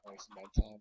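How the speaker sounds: ordinary talk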